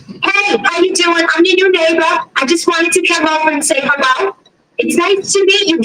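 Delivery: put-on high, chirpy neighborly hello in a Boston accent. Woman narrator imitating her nosy Boston neighbor's greeting, high.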